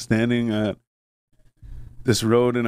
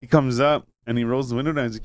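usual speaking voice

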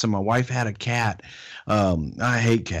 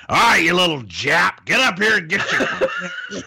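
high, loud mock gruff heckle; Freddie's heel voice; 8 semitones higher, 4 dB louder than usual. Retelling the Hall of Fame speech, mock heckling his wife. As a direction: bark it out like a wrestling heel, hammed up. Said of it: "and he goes".

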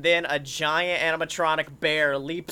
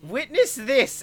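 villain Herzog voice. Acted. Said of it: "And he goes".

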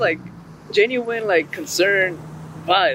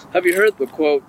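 usual speaking voice

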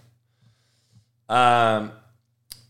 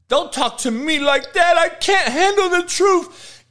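mocking whiny voice. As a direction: whine like a crybaby being scolded. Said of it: "mocking a weak viewer".

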